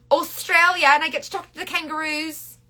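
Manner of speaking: put-on playful, high-pitched go at an Australian accent; badly faked Australian accent; attempted Australian accent. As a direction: have a silly try at sounding Australian. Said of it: "kangaroo accent. So the Australians, I'm really sorry".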